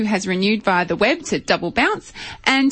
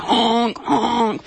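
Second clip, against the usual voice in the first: goose honk impression; 2 semitones higher than usual. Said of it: "if only for the impersonation".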